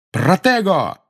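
high, loud spell cry; shouted spell. A narrator's reading. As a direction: yell the spell out. Harry casts a shield spell.

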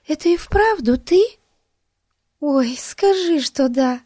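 put-on high, eager pleading girl voice; excited girl. A narrator's reading. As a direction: beg him to say yes. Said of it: "прошептала Бетси".

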